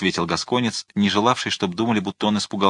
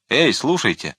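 ordinary delivery

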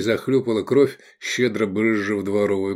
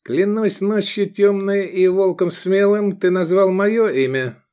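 high, louder, thin-voiced oath; 10 semitones higher, 4 dB louder than usual. A narrator's reading. Gloomy man swears by night and wolf, thin high voice. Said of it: "неожиданно тонким голосом".

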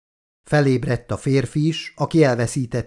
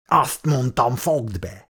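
snarling threat; slower than usual. A narrator's reading. Thug snarling at the screaming woman to shut up. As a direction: growl it as a menacing order. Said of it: "vicsorgott a férfi".

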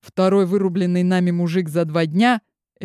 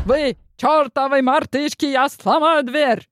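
roaring chef; 8 semitones higher than usual. Acted. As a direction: roar it like an angry cook. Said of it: "проревел заворотенный шеф-повар".

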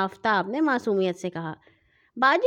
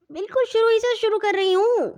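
much higher, exasperated snap-back; irritated retort; 12 semitones higher than usual. A narrator's reading. Sister irritably answering her mother's nagging, exasperated. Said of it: "باجی نے جھلا کر کہا".

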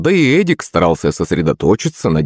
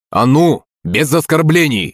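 high, firm call to order; mild teacher's shout; 8 semitones higher than usual. A narrator's reading. Teacher breaking up pupils' insults, calling out. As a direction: raise the pitch to stop the insults. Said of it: "закричал Хрякин".

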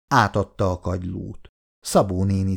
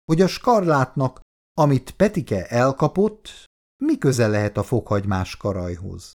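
plain reading voice

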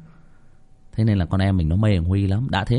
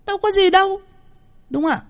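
much higher, squeaky "no big deal"; squeaky shrug; 3 dB louder, faster than usual. Acted. Host brushing off authors' worries in a squeaky falsetto.

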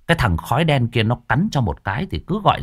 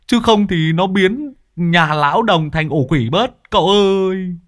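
much higher, louder frantic pleading; panicked messenger; 7 semitones higher, 4 dB louder than usual. A narrator's reading. Lâm Sẹo begging the master to hurry, frantic and loud. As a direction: scream for the master to come now.

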